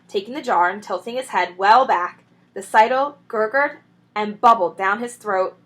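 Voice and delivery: plain reading voice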